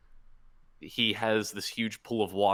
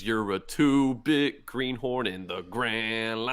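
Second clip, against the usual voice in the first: slow, hammed-up cartoon villain quote; Crocodile; slower than usual. Podcaster quoting the villain's taunt, hammed-up slow villain voice. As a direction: draw it out like a dubbed cartoon villain. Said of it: "I hope he said it like that".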